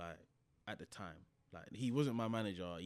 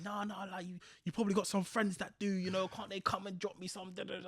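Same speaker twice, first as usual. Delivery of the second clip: imitating the manager; 10 semitones higher than usual. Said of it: "And he's like".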